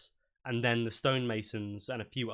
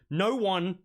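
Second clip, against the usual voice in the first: higher, louder firm command; stern dwarf; 7 semitones higher, 4 dB louder than usual. Acted. Griselda forbids anyone leaving, higher and louder. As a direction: stop them in their tracks. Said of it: "pipes up and goes".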